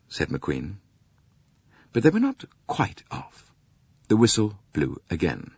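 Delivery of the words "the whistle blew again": plain reading voice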